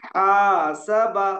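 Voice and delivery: हासबा drawn out very slowly and loudly; very slow haasaba. Shown as a demonstration. Teacher demonstrating हासबा slowly for students. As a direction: hold every syllable of हासबा. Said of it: "अब मैं दो चार हर्फ पढ़ के सुनाता हूँ".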